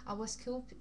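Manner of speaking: usual speaking voice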